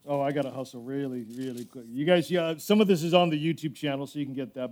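rushed, hushed housekeeping note mid-sermon; hurried quiet aside. Real, not acted.

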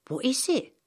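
put-on a curious young girl asks, lifted and bright. Samantha asks.

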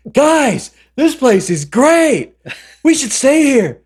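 high, loud, drawn-out delight; giddy enthusiasm. Acted. Ray loves this old place, gushing at high pitch. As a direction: burst out like an overexcited kid.

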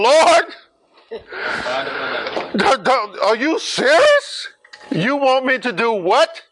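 high, loud, panicked pleading; a frightened servant begging the Lord; shaking with fear. Acted. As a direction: Ananias protesting in alarm. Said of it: "Ananias is, is shaking".

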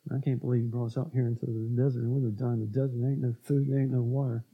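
muttered low and soft, with an edge; low grumbling mutter. Retold or imitated. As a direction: grumble it under your breath like a complaining Israelite. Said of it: "low volume speech that has an edge".

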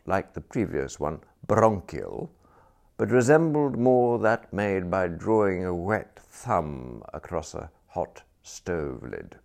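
ordinary delivery

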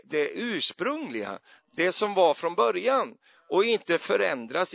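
preacher exhorting the congregation; preaching. Acted. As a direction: preach it with rising conviction.

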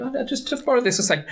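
high, quick breezy voice; high casual voice. Retold or imitated. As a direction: rattle it off lightly, high. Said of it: "he's going".

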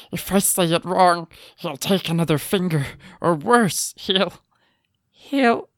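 high, panicky captive voice; terrified prisoner. Acted. Acting terrified prisoner's thoughts.

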